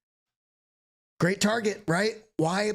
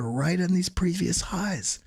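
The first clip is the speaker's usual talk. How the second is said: ordinary delivery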